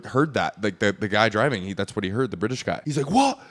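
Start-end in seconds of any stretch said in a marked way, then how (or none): 3.1-3.4 high, loud mock outrage; ballistic. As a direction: shriek it like a man losing it. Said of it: "he goes fucking ballistic".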